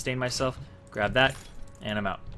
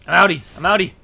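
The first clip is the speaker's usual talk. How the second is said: much louder, higher getaway call; excited exclamation; 7 semitones higher, 13 dB louder than usual. Real, not acted. Gamer announces the escape on stream, excited loud call. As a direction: call it out loudly as you escape.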